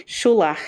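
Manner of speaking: Scholach, final ch rasped in the throat; ch like clearing a bug from the throat; Scottish pronunciation. Shown as a demonstration. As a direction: say Scholach with the loch sound. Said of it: "bug stuck in the back of your throat".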